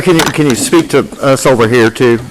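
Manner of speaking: ordinary talk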